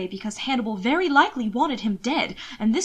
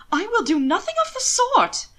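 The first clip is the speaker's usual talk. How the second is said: high, louder indignant protest; appalled; 11 semitones higher, 3 dB louder than usual. A narrator's reading. Will refusing to undress, sounding appalled and scandalized. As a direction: recoil in prim, scandalized refusal.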